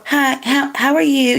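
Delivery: mocking high-pitched hello; put-on high voice, falsely pleasant; fake-friendly greeting. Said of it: "You so fake".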